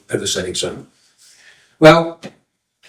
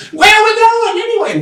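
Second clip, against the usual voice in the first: high, comic relayed call. Acted. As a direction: echo the question high up the line. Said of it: "they call out".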